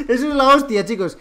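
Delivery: laughing in delight. Real, not acted. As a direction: crack up at the collapse. Delighted by collapse.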